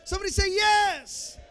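preacher shouting for the congregation's answer; shouted call. Acted. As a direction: push the crowd harder. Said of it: "call repeated louder".

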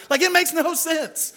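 incredulous. Real, not acted. Preacher stressing absurdity.

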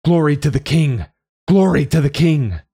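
loud, high chant of praise; roaring crowd. A narrator's reading. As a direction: roar it out like a cheering crowd. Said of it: "they roar their answer with one voice".

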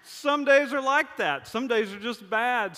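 high, loud, slow overdone wail; exaggerated mournful cry; mock lament. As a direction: ham up the despair for the kids. Dramatic comic lament to kids.

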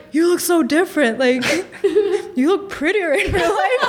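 high-pitched gushing through laughter; laughing, high. Retold or imitated. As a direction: gush at her, laughing. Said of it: "And they're like".

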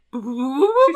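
loud, quick imitated booping; rapid-fire mock shooing noise; shooing boops. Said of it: "he does this, like, hand sign".

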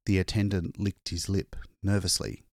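ordinary delivery

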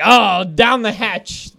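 loud, drawn-out "oh, down the hatch"; hearty, stretched-out cheer; eager exclamation. Retold or imitated. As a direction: toss it out boldly, slowly and loudly. Eagerly taking the first edible.

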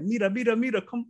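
imitating her calling a friend over; high calling out. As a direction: cleaner calls another cleaner to come look, high and eager. Cleaner calls coworker.

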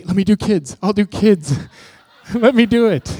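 high, loud mock eagerness; pleading to be picked, hammed up; over-eager volunteering. Begging to take kids class as an escape, over-eager.

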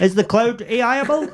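laughing. Acted. Repeating the catchphrase, laughing.

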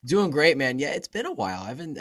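ordinary talk, nothing marked

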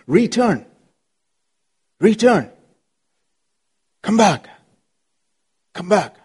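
pleading call. Acted. Preacher calling people back.

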